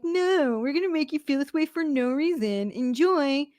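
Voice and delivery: high, mock-gleeful refusal; voice of the body. Body taunting its owner about anxiety, mocking. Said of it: "our bodies are like".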